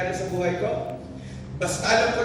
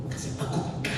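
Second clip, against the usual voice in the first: whispered; 9 dB quieter than usual. Acted. Pastor whispering to tired young professionals. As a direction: drop to a hushed whisper.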